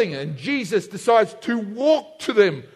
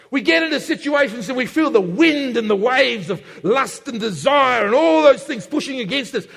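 normal talking voice

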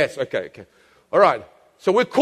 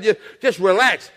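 ordinary talk, nothing marked

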